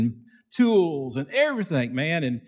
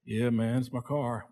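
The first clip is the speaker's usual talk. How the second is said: much softer, lower sheepish reply; 3 semitones lower, 8 dB quieter than usual. Retold or imitated. Rich friend answers the preacher, sheepish and soft. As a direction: mumble it, embarrassed to own it. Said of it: "kinda sheepish".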